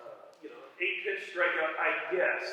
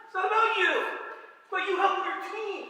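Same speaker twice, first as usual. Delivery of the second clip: high, loud hammed-up shout; mock yell; 14 semitones higher, 7 dB louder than usual. Coach shouting at his hitter that it's about the team, loud and high. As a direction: shout it at the player like a fired-up coach. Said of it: "Excuse me, I didn't say that".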